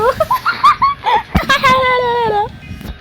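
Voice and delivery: long, high trilling "ha-la-la" laugh; silly high cackle. Real, not acted. Gamer after a cheeky joke to a friend, high silly cackle. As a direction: cackle in a drawn-out high warble.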